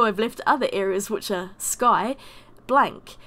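normal talking voice

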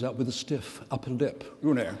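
lightly mocked snobbish British; a touch of stiff-upper-lip British; very British accent. Speaker mimics the snobbish manager, lightly put-on British accent. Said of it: "very British, you know, spoke that kind of accent".

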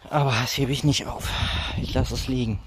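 ordinary talk

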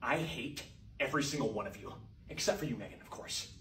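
low, soft muttering; muttered grumble. Acted. Teacher muttering to the class, softly.

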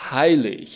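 "Heilig" ending in a soft breathed ich-sound. Shown as a demonstration. Teacher models the IG suffix, "Heilig" ending like the h in odihnă. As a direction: say "Heilig" as "hai-lich", no hard g. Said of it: "Se pronunță ca în odihnă, în sufixul".

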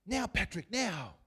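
high, loud sideline shout; yelled urging. Acted. God yelling to Patrick from the sidelines, loud mock shout. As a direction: holler it like a cheering coach. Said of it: "He'll yell out".

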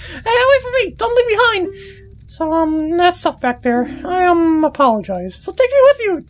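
high, indignant fairy scolding; bossy fairy. Acted. Tatl blaming Deku boy for losing her brother, high and indignant. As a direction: snap at him like a bratty little fairy.

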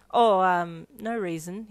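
loud oh, then awkward dismissal; slightly uncomfortable. A narrator's reading. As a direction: brush it off uneasily. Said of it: "slightly uncomfortable about something".

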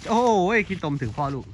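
slow, loud, high groan of dismay; dismayed exclamation. Real, not acted. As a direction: react to getting splashed. Mud splashed on him.

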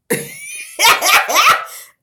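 very high squealing laugh; real high squeal of laughter. Real, not acted. Laughing at ugly cry idea.

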